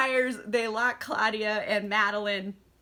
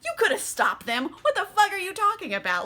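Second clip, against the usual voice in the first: higher, incredulous retort; mock calling-out; 8 semitones higher than usual. Louis calling out Armand's excuse, mock indignant. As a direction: challenge the excuse, rising in pitch. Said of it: "he calls him out on his shit".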